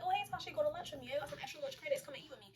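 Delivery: lightly put-on friendly come-on; casual invitation. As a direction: play a sly suitor's casual ask. Voicing Art's sly lunch invitation.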